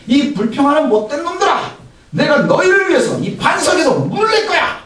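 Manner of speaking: Moses roaring at grumblers; mock angry shout. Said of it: "소리를 멋지게 지르는 거예요".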